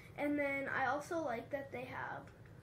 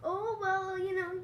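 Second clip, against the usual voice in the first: higher, louder sing-song brush-off; mock nonchalance, pitched up and loud; dismissive shrug; 5 semitones higher, 6 dB louder, slower than usual. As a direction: voice a breezy, let-off-the-hook shrug. Voices someone dodging an apology.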